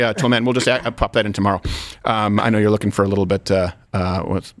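ordinary talk, nothing marked

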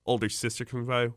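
ordinary delivery

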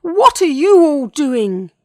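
high, loud, slow cry of panic; worried dinosaur's yell; alarmed. A narrator's reading. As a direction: demand it in rising panic. Dinosaur alarmed by swarming animals.

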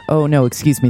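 usual speaking voice

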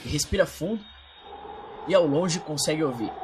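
low, soft narrator voice; dramatic storytelling. Acted. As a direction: tell it like a tense film scene.

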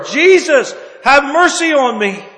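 loud, desperate cry. Acted. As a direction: shout out begging for mercy. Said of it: "he cried out the more".

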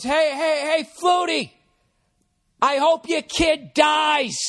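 high, slow stammering yell; dumb buddy heckling. Retold or imitated. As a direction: blurt out a heckle that goes way too far. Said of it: "he goes".

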